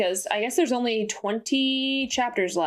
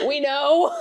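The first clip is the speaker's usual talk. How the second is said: high burst of laughter, then we know; 8 semitones higher than usual. Real, not acted. Friend laughing that they already discussed it.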